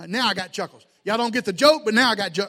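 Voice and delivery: ordinary talk, nothing marked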